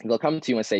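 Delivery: usual speaking voice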